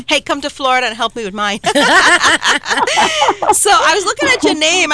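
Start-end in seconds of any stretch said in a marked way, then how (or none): 1.7-3.5 loud, high burst of laughter; hearty host laugh; big laugh. Real, not acted.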